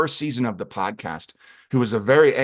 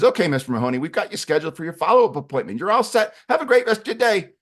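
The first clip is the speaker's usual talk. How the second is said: quick receptionist patter, rising higher and louder; said fast; 6 semitones higher, 4 dB louder, faster than usual. Acted. Receptionist checking Claudio out at the clinic, said fast. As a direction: rattle off a chirpy sign-off, running words together. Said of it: "she kind of says it fast".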